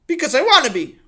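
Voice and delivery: put-on high, loud child's back talk; bratty outburst. Sammy talks back to his father, bratty shouting. Boy answers back rudely.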